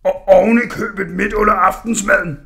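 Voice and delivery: loud bellowing smith; roaring. Acted. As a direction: roar it at the kid. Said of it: "brølede smeden".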